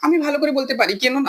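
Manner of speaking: woman's voice. Pretend. Answers in Bengali, raised voice.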